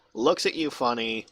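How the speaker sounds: normal talking voice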